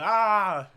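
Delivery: a short, high, loud retold wail; mock anguished cry. Vin Jin breaking down mid-choke, a loud high wail. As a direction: let out a pained cry as you stop yourself. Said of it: "he starts crying like".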